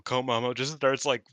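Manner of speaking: normal talking voice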